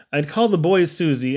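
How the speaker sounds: plain reading voice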